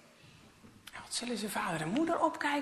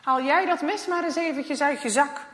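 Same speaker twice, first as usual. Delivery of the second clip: stern man's voice; 3 semitones higher, 10 dB louder than usual. Acted. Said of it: "die meneer zegt".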